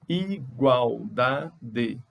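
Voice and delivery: "Igualdade" stretched out very slowly; syllables counted out. Shown as a demonstration. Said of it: "Ingredientes tem mais de três". ("Igualdade" muito devagar, contando as sílabas)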